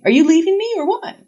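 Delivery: loud, high, cheeky demand; blunt confrontation. A narrator's reading. How Sarah would confront a partner, blunt. Imagined blunt question to boyfriend.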